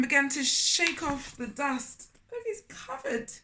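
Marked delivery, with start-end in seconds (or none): none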